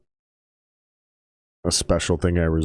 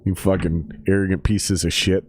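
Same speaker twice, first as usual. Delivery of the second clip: normal talking voice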